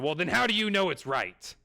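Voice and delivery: high, loud challenge; angry old man. Retold or imitated. Old bowler challenging the clerk, high and loud. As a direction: catch the kid out. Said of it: "he starts yelling".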